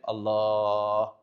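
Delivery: a long, low, slow الله; drawn-out admiration. Acted. Teacher to student, mock-impressed by the answer, drawn out. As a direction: savour the word الله as if impressed.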